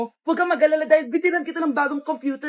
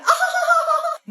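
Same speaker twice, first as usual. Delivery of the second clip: high laugh; 11 semitones higher than usual. Real, not acted. Laugh after joke.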